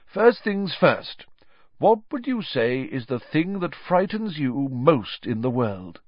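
encouraging professor voice; Professor Lupin questioning. Acted. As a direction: gently lead the pupil into the question.

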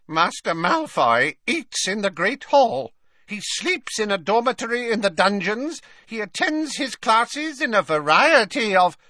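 high-pitched elf voice with grudging fury; furious house elf. A narrator's reading. Scolded elf giving his report, high-voiced and seething. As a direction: recite the report resentfully as a creature. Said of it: "looking furious, and then said".

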